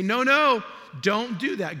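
loud, high "no, no!" to stop the driver; urgent warning shout. Pretend. Passenger shouting at the driver to stop. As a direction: yell to stop a wrong turn.

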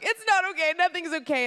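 high, loud imitated wail; tearful outburst. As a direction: cry it out, hammed-up. Retold tearful breakdown after hug.

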